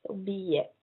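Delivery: billet as bee-yeh, double L like a Y; soft billet. Shown as a demonstration. Teacher repeating ticket word for learners, soft and careful. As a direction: say billet softly with a Y glide. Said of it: "same sound as the Y".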